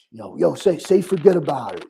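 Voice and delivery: put-on a tourist trying out a New York accent; mock New York accent. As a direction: play the outsider mimicking a New York accent. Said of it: "they're like".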